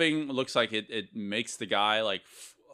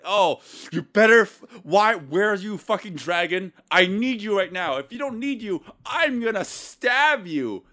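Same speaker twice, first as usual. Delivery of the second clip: mock furious threat; 8 semitones higher, 7 dB louder than usual. Max Jang threatening his dragon tattoo, hammed-up fury. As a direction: ham up a desperate man threatening his tattoo. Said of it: "It's like".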